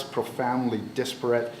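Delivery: ordinary talk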